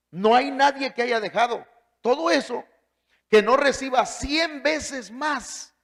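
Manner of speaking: high, loud preaching of the verse, slowing on the promise; fervent scripture reading. Acted. Preacher reading Mark to the congregation, high and loud. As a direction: proclaim the verse like a revival preacher.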